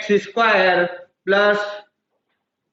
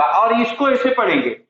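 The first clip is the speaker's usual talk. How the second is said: ordinary delivery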